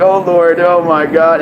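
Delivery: loud, high shocked cry; loud retold disbelief; shocked exclaim. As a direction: react to something outrageous. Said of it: "I'm like".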